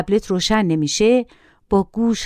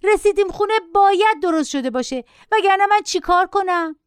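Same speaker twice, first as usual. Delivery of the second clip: high-pitched whining protest; shrieking child; 10 semitones higher than usual. A narrator's reading. Boy protesting to his mother about waiting, shrieking. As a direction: play a screechy boy refusing to wait.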